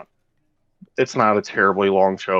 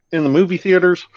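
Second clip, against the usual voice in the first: put-on excited kid; 7 semitones higher, 3 dB louder, faster than usual. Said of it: "so kids can be like".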